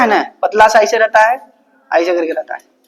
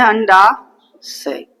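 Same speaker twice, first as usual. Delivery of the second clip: अंडाशय stretched long and slow; teacher drawing out अंडाशय for the class; much slower than usual. Shown as a demonstration. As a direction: drag out अंडाशय for students to repeat. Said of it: "क्या बोलते हैं?".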